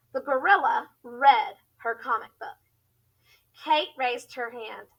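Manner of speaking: ordinary delivery, nothing marked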